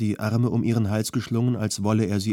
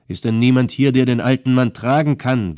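much louder call to the bystanders; calling out to the crowd; 2 semitones higher, 7 dB louder than usual. A narrator's reading. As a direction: shout it to the people standing around. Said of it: "wandte Sergeant Osman sich an die Umstehenden".

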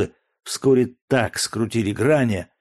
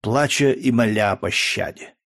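ordinary delivery, nothing marked

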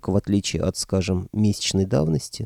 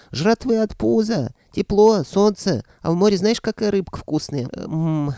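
high, excited squeaky creature voice; squeaky dragon head; 12 semitones higher, 4 dB louder than usual. A narrator's reading. Left dragon head boasting about food and sun, squeaking. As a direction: squeal with delight about the good life. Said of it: "пропищала левая голова".

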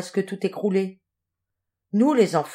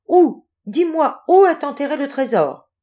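high, loud burst of questions; urgent excited demand; 7 semitones higher, 6 dB louder than usual. Acted. Jules jumps up and begs the lion to tell him, urgent and excited. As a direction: blurt it out, desperate to know. Jules leaps up, begging for answer.

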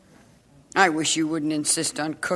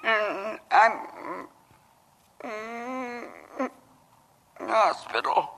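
slurred speech; 7 dB quieter, slower than usual. Acted. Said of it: "It was a stroke".

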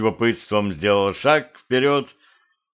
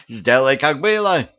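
higher-pitched panicky demand; frightened yelling; 5 semitones higher than usual. A narrator's reading. Blonde head torn from its place, frightened yelling. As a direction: beg in alarm to be put back. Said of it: "испуганно орала голова".

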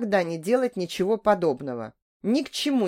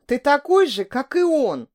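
higher and louder, near sobbing; tearful outburst; 6 semitones higher, 5 dB louder than usual. A narrator's reading. Betrayed woman accusing lover, louder and tearful. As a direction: throw it at him through tears. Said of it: "всхлипнула я".

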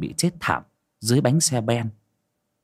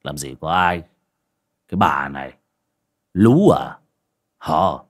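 low-pitched baffled husband; bewildered; 5 semitones lower, 4 dB louder than usual. A narrator's reading. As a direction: dismiss it in puzzled disbelief. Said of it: "ngơ ngác".

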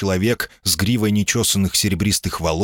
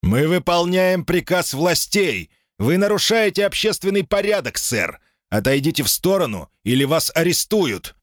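shouting orders over the crowd; loud resolute command; 9 semitones higher than usual. A narrator's reading. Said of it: "громким, зычным, решительным".

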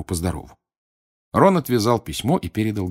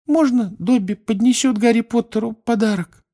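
very high timid elf voice; timid squeaky elf; 4 dB louder than usual. A narrator's reading. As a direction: ask shyly in a tiny squeak. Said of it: "робко спросил он".

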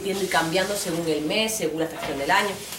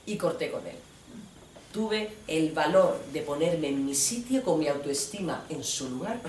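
ordinary talk

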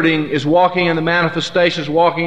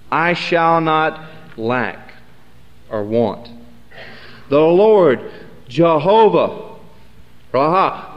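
scripture reading; 2 semitones lower, slower than usual. Acted. Reads Psalm twenty-three aloud.

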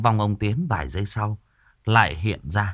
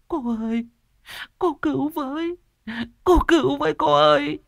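high, whining cry for help; wailing plea. A narrator's reading. Neighbour wailing to Cô An to help her son. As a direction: wail it much higher. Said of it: "kêu gào".

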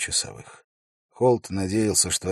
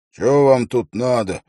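loud, raised, rough-mannered demand; gruff challenge; 5 semitones higher, 9 dB louder than usual. A narrator's reading. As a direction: cover your uncertainty with rudeness. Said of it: "буркнул он, скрывая неуверенность под маской грубости". (грубый громкий окрик, чтобы скрыть неуверенность)

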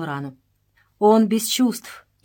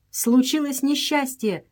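shouted news; 6 semitones higher, slower than usual. A narrator's reading. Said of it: "закричал Эдуард".

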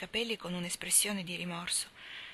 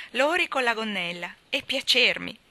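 louder, teasing exclamation, pitch lifted; louder gleeful cry of amused disbelief; delighted exclamation; 3 semitones higher, 8 dB louder than usual. A narrator's reading. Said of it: "esclamò Joe levando ambedue le braccia".

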